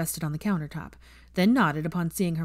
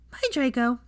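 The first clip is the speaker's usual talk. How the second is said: put-on little boy Teddy; 7 semitones higher than usual. Teddy greeting Hermione at the door, excited child voice. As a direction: squeal it like an eager little boy.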